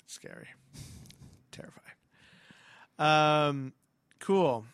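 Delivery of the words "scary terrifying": breathless whisper; admitting to a co-host the game is terrifying, whispered. Real, not acted. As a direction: whisper it as if still scared. Admitting the game scared them.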